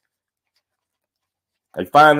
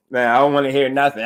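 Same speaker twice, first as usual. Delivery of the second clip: ordinary delivery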